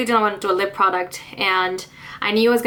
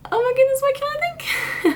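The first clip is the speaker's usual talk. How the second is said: exasperated squeal. Real, not acted. Vlogger blanking on a product name, high exasperated squeal.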